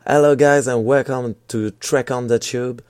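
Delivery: put-on lent et fort, avec l'accent anglais; YouTuber in English. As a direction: imite l'intro d'un youtubeur anglophone, lentement. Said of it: "la phrase d'un magnifique YouTuber". (accent anglais de youtubeur, plus fort)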